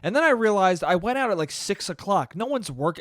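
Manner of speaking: ordinary talk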